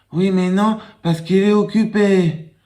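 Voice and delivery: put-on high little boy voice. Small boy telling caller everyone is busy, high child voice. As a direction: answer like a small child, matter-of-fact.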